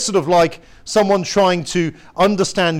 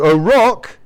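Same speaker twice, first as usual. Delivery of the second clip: ringing proclamation; 5 semitones higher, 8 dB louder than usual. Acted. Preacher repeats it, stronger.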